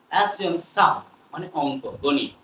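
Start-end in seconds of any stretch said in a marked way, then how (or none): none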